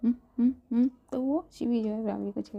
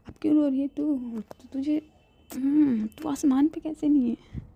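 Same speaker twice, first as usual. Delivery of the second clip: higher, louder playful scolding; excited teasing; 6 semitones higher, 7 dB louder than usual. Acted. Friend cheering up her crying best friend, excited. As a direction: tease her out of tears.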